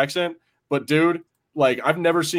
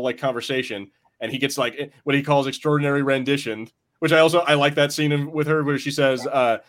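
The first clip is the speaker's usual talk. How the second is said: ordinary talk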